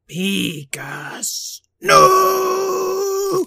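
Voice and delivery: angry creature cry rising high; crackling lightning spirit. A narrator's reading. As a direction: snarl the name as lightning bursts. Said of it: "blast of frosty lightning".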